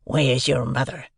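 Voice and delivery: rough convict character voice; menacing convict. A narrator's reading. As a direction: question a child like a menacing fugitive. Convict questioning the boy.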